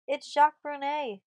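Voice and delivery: loud, high-pitched call. A narrator's reading. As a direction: call the news across the fort. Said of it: "called Zanzra".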